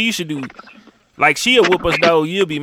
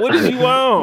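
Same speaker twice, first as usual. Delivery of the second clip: incredulous; 6 semitones higher than usual. Retold or imitated. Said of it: "I'm like".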